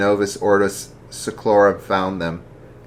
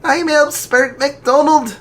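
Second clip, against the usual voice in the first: proud daughter voice; 5 dB louder than usual. Acted. Daughter introduces herself.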